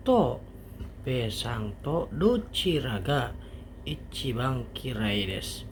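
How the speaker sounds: normal talking voice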